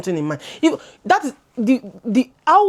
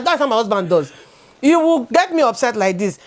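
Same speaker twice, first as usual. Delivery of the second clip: usual speaking voice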